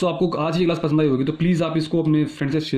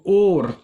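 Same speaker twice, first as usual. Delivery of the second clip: "or" punched louder and higher; stress on OR; 4 semitones higher, 5 dB louder than usual. Shown as a demonstration. Teacher flags the either-or option, "or" stressed loudly. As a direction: hit "or" hard as a lone word. Repeats key word of the option.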